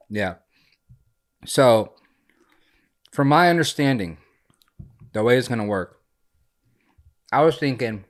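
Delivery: talking with ice in mouth; words round a mouthful of ice. Real, not acted. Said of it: "oh, I got ice in my mouth".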